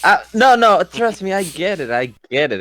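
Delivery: usual speaking voice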